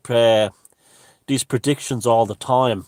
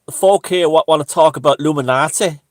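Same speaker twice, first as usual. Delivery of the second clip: loud, higher-pitched mock Yorkshire; thick Yorkshire accent; 5 semitones higher, 10 dB louder than usual. Retelling a woman at the club event, thick Yorkshire accent. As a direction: imitate a broad Yorkshire woman, projecting loudly. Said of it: "says in this thick Or-, Yorkshire accent".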